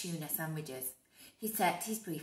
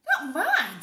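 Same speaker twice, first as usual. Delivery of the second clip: put-on child; 6 semitones higher, 12 dB louder than usual. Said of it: "said another".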